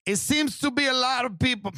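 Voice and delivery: high put-on Spanish accent; mocking a grieving poster's Spanish accent; Marisa. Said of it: "now I'm doing Marisa".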